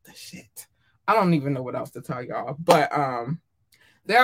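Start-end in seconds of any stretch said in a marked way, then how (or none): 0.0-0.7 whispered. Real, not acted. Cusses under her breath.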